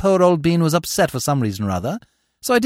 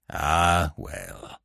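put-on deep, soft old man's sigh of words; mollified old uncle; 11 semitones lower, 6 dB quieter than usual. A narrator's reading. Old uncle after wiping his eyes, deep and resigned. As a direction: let it sink away low.

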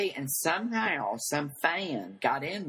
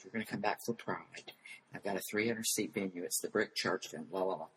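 retold whisper; 5 semitones lower, 7 dB quieter than usual. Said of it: "He whispered to me".

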